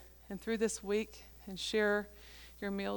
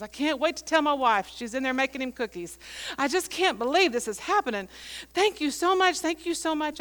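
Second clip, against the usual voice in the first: higher, louder, gushing thanks; retold overwhelmed gratitude; 5 semitones higher, 5 dB louder than usual. Old man thanks the preacher for the gifts, overjoyed. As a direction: act out the old man overcome with joy. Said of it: "And the tears are coming".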